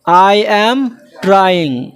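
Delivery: very slow, word by word for the class; slow dictation pace; slow model sentence. Shown as a demonstration. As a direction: spell it out slowly so students can repeat. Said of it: "কি হলো?".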